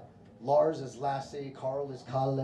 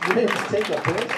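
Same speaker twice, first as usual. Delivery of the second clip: louder incredulous question; mock disbelief; 6 semitones higher, 7 dB louder than usual. American mocking the name Jan, loud incredulous question. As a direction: sound appalled at the name. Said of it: "I'm like".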